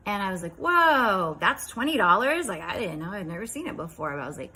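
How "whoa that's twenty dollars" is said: high, loud, wowed reaction; amazed. Retold or imitated. Reacting to the boy's big silver coins, amazed. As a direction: act out wide-open amazement.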